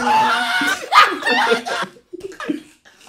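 long, very high, loud laugh; shrieking laughter. Real, not acted. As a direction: burst out in a screaming laugh. Cracking up at the voice.

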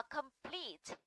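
complete with the weight on PLETE; light com, then a stressed long ee in complete; stress on second syllable. Shown as a demonstration. Teacher gives a verb example, complete stressed on PLETE. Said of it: "vào pleat".

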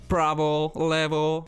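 Polish commands in an English accent; mock English-accented command; put-on English accent. Shown as a demonstration. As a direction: say it as if for English speech recognition. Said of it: "mówić z jakimś akcentem".

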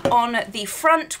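ordinary delivery

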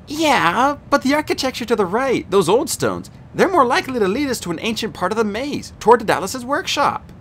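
a hint of a smart, doubtful teen girl; lightly voiced Annabeth. A narrator's reading. Annabeth argues with Percy about which tunnel.

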